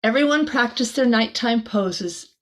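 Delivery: ordinary delivery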